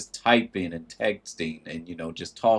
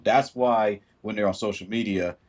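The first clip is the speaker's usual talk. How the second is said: ordinary delivery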